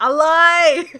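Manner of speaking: loud, high burst of recognition; excited shout of the slang word; excited exclamation. Real, not acted. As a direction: shout it out gleefully.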